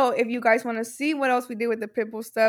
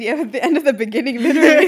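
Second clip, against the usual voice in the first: laughing; 7 dB louder, faster than usual. Real, not acted. Laughing at own joke.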